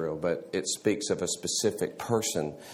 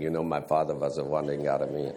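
put-on students parroting the phrase in a light Dutch accent, fast; quick mock Dutch accent: "vas a vandering Aramean"; 6 semitones lower, faster than usual. Students joking around with the phrase, in a mock Dutch accent. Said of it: "we all walked around saying".

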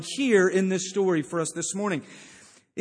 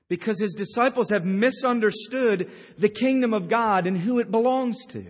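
normal talking voice

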